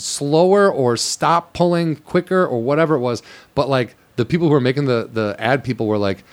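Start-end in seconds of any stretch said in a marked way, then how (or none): 0.0-2.5 slow pleading. Retold or imitated. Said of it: "and I was like".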